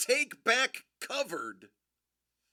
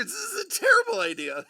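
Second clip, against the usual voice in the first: fast, gleeful half-shout; 9 semitones higher, much faster than usual. Real, not acted. Host gloating over a bad pick, fast gleeful shout. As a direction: rush it out with mischievous glee.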